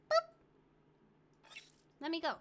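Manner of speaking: ordinary talk, nothing marked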